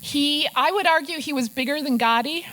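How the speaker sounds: ordinary delivery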